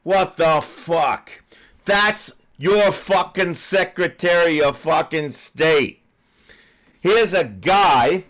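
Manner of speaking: loud, high, slowed furious rant; venting real rage at a lying official; real anger. Real, not acted. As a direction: shout it out, fed up. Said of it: "and I'm getting fucking upset".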